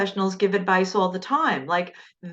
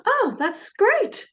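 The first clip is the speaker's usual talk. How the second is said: much higher, slow mock delight; amazed doctor; much slower than usual. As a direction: drag it out high like a stunned doctor. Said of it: "And he goes".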